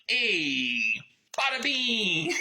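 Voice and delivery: a hint of a sleazy wise guy; crummy mobster. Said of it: "he's like".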